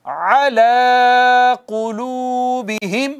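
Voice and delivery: अला कुलूबिहिम intoned slowly with a throaty ain; slow Quranic recitation. Shown as a demonstration. Teacher reciting for students to repeat. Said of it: "पढ़िएगा मेरे बाद".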